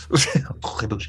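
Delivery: slow, exaggerated French accent. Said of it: "say it in a French accent".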